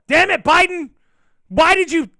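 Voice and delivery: high, loud mock-furious shout; hammed-up shouting at a politician; mock outrage. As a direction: yell the blame as a joke, then run out of words. Mock blaming Biden for everything.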